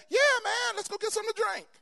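high shouting party call; rowdy call to go drinking; excited friend. Retold or imitated. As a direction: yell it like a buddy ready to party. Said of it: "And I'm like".

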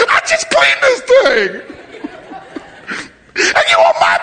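very high-pitched hammed-up hysterical yelling; yelling at him. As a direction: wail and holler like a panicked, outraged disciple. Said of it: "we're yelling at him".

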